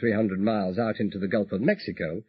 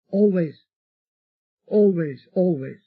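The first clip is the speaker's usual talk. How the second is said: higher, fading repeated promise; voiced Elizabeth, loving; 8 semitones higher, 5 dB louder than usual. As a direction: soften with each always.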